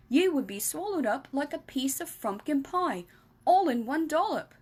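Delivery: lightly voiced friendly giant explaining; BFG voice. Giant explaining to Sophie, lightly voiced.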